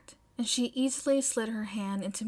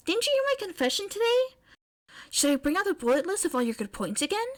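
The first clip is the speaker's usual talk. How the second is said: fast eager May; 8 semitones higher, 4 dB louder, faster than usual. Acted.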